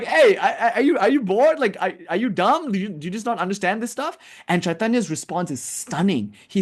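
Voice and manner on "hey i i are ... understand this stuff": high, stammering retold telling-off; higher-pitched imitation of an exasperated guru; mock scolding. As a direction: scold a pupil in disbelief, half amused. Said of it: "So he scolded him".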